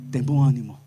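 low, steady reassurance; Jesus reassuring the beggar, low. Retold or imitated. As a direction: answer firmly and calm.

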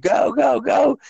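high, loud yell of go go go; mock coach yell. Coach yelling at players to run, high mock shout. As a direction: bark them into running, hammed up. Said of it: "he's like".

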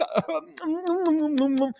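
laughing. Real, not acted. Laughs at own mockery.

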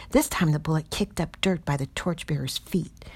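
ordinary delivery, nothing marked

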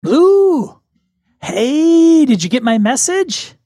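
high, delighted phone hello; excited greeting. Acted. Eloise answers Lupita's phone call, excited greeting. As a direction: greet a friend in a high, drawn-out squeal of excitement. Acted surprise call from old friend.

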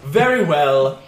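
loud theatrical gentleman; grand assent. Acted. Game master as the stranger agreeing to help, grandly. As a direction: agree with a showman's flourish, loudly.

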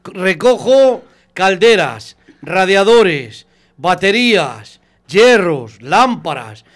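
drawn-out list of items; slow listing. Real, not acted. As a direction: spell out the list slowly. Listing scrap he collects. (enumerando despacio)

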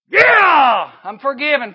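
high, loud cry of joy; joyful shout. Acted. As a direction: burst out with relief. Thief rejoicing forgiven.

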